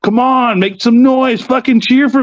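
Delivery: hammed-up wrestler demanding noise; imitated crowd taunting. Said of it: "be like".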